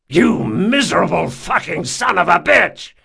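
loud, high raging shout; raging curse. Acted. Jean curses Locke for the switched wine, raging shout. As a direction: spit the curse at him.

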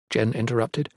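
plain reading voice

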